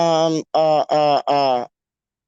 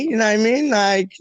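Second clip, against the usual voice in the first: ordinary talk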